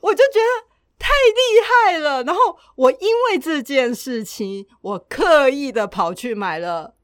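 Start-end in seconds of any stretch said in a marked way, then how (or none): none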